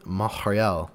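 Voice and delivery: "Montreal" said the French way, silent t; French pronunciation. Real, not acted. Host naming the studio's city, "Montreal" pronounced in French. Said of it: "You just said you wouldn't do the accent".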